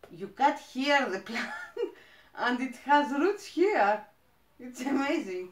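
giggling, rising excitement; delighted giggle as the words come; laughing delight. Real, not acted. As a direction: laugh through the words with joy. Thrilled over rooted plant cutting.

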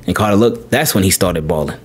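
usual speaking voice

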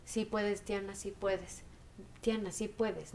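a soft, slow mantra repeated to herself; quiet mantra. Retold or imitated. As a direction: murmur it slowly, encouraging yourself. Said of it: "empiezo a hacer mi propio mantra". (mantra suave y lento para sí misma)

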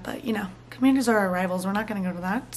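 hushed joking aside; conspiratorial whisper. Real, not acted. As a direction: whisper it like a secret. Joking aside about dad's team.

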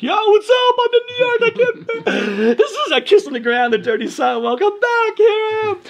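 very high, loud shout with laughter; mock overjoyed kid; ecstatic arrival. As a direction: yell it like you just landed. Excited arrival in New York.

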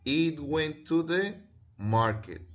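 teacher dictating the answer slowly; slow model sentence. Shown as a demonstration. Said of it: "su enunciado debería decir".

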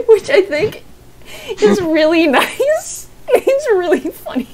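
high squeal through real laughter; laughing hard. Real, not acted. Streamer complimenting a friend's GIF, laughing hard. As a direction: squeeze the words out between laughs.

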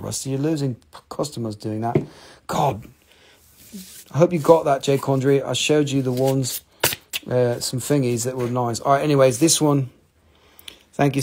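ordinary delivery

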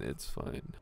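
whispered it's fine to self. Real, not acted. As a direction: breathe "it's fine" unvoiced.

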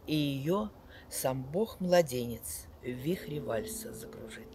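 loud, sweeping poetry recital with big swells; dramatic poetry declamation. Acted. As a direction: declaim each line like on stage. Reads poems aloud to audience.

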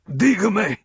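very high, loud strangled cry; hanged alcalde gasping for mercy; desperate gasp. A narrator's reading. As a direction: plead with no air left. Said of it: "gasped the alcalde".

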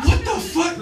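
high shocked yelp; shocked outburst. Retold or imitated. Teen catching the neighbour spying, high shocked yelp. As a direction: react like you just got caught spying.

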